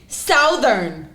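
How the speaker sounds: Southern said "SOW-thern", every part spelled out; Southern carefully as south plus -ern; pronouncing it fully. Shown as a demonstration. Defending her way to teasing friends, "SOW-thern" said in full. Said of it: "I'm pronouncing it fully".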